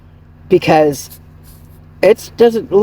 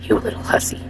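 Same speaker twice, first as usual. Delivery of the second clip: whispered insult; 9 dB quieter than usual. Retold or imitated. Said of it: "She's like".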